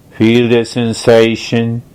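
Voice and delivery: normal talking voice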